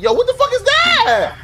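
high-pitched, drawn-out disbelief; real startled exclamation; shocked outburst. Real, not acted. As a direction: blurt it out high, stretching the words. Startled by sight in game.

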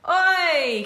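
high called-out oi; playful holler for children to copy; calling out. Shown as a demonstration. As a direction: call it up to the ceiling. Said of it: "Can you say oi to the ceiling?".

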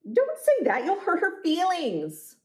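high-pitched, protective protest; acted indignation in a high voice; offended dog owner. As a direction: leap up high, scolding on the dog's behalf. Offended dog owner in story dialogue.

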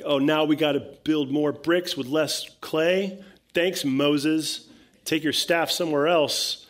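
acted fed-up complaint; complaining. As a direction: grumble sarcastically at the leader who caused the trouble. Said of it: "so Israel is complaining".

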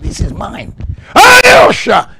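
high, explosive preacher's shout; shouted praise. Real, not acted. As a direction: burst into a full-force hallelujah cry.

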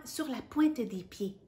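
usual speaking voice

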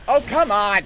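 high, loud protest; real exasperated outburst; frustrated shout. Real, not acted. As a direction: yell in exasperation at the game. Frustrated by the game.

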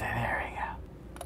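hushed, drawn-out whisper; under-the-breath coaxing. Real, not acted. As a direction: whisper it slowly while easing a part into place. Easing a part into place.

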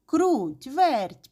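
high, loud drawn-out names; calling out. A narrator's reading. Rooster summons the two mice, calling out. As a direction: call the two mice over. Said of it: "покликав півник".

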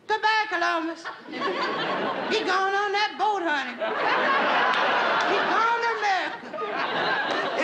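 high, drawn-out screaming farewell; screaming girls. Acted. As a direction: wail goodbye like excited girls on the pier. Screaming girls seeing Columbus off.

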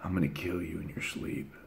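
very hushed, low sinister murmur; devilish threat. Retold or imitated. Brother threatening him again: hushed devilish threat. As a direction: breathe the threat low and menacing. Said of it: "he was like".

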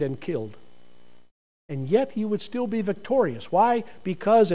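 ordinary talk

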